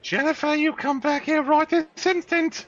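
very high put-on mother voice, drawn out; scolding mother. Mother calling naughty Jennifer back, high-pitched scolding.

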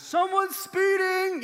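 drawn-out announcing shout; calling out from another room. Retold or imitated. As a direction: call it out across the house like a little hall monitor. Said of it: "he's just calling it out".

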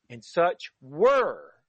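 "were" drawn out long and slow; slow phrase landing heavily on "were"; stress on "were". Real, not acted. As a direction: lean hard on "were", stretching it out. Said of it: "I'm emphasizing the word were".

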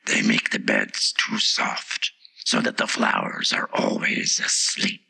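very low, slow whispery voice; explaining Tiger Lily. A narrator's reading. The Tiger Lily explains to Alice, low and slow. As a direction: explain deep and slow, all breath.

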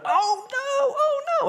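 very high, loud yelp; squealed panic; horrified interpreter. Retold or imitated. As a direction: gasp in horror. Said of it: "the interpreter went".

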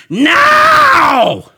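huge high-pitched drawn-out shout; old man's yell. Retold or imitated. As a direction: yell it right in their face, long and high. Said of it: "he yells right in my face".

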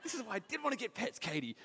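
high-pitched, exasperated retold gripe; half-laughing told-you-so scolding; mock angry complaint. Husband to wife after pet mice died, mildly angry. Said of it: "I got a bit angry at my wife".

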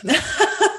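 short high laugh; bright laugh. Real, not acted. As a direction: laugh at yourself. Laughs at own remark.

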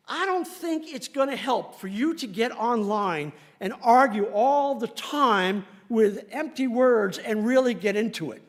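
ordinary talk

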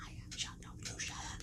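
acted whispered retort; bickering whisper. Two hidden kids arguing, imitated bickering whisper. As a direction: squabble under your breath.

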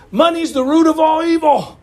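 mock the saying in a high, pushed voice; mocking quote. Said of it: "some of these people will say".

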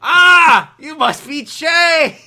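loud, high, drawn-out cartoon shout; startled cry, then stretch it out loudly; TV cartoon park ranger. Showing the TV show's ranger greeting Chase, exaggerated shout. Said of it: "if you were from the T V show".

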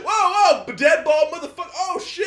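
high, loud panicked yelping; mock panic. Friend recapping a movie, acting scared in a high yelp. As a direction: freak out at the bald guy in high alarm. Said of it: "'Cause I would've been like".

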